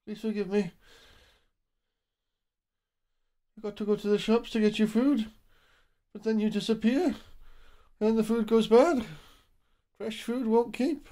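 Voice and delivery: sorry-to-the-pet voice; pet-talk apology. Real, not acted. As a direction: coax the cat tenderly. Pleading with the cat.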